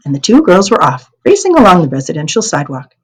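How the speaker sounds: ordinary delivery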